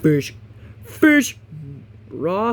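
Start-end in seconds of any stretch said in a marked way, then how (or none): 0.8-1.4 excited. Shown as a demonstration. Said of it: "repeated with emphasis".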